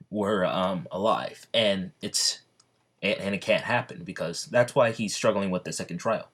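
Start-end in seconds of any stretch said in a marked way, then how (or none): none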